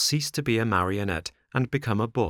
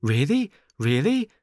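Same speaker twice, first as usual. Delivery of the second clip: high, loud, bouncing disbelief; joyful cry; 5 semitones higher, 4 dB louder than usual. A narrator's reading. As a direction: leap up with happy surprise. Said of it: "cried the marionette, jumping around with joy".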